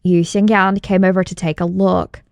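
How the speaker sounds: normal talking voice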